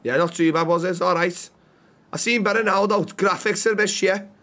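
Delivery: casual put-on Scouse; Scouse accent. Bystander reviews an old show, in Scouse accent. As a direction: chat like a Liverpool local. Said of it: "Scouse, okay".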